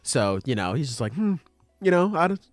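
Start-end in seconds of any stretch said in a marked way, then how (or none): 1.1-2.4 higher, put-on casual voice; feigned nonchalance. Boy playing nonchalant during a spat with a girl, feigned nonchalance. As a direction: play it cool and noncommittal in a raised voice. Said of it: "Okarun trying to play nonchalant".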